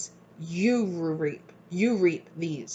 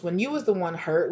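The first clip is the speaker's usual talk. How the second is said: ordinary delivery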